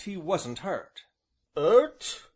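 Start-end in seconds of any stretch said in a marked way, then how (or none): 1.6-2.3 blunt, louder rustic echo; blank gruff Job. A narrator's reading. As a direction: throw the word back, puzzled and gruff. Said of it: "he repeated with a blank stare".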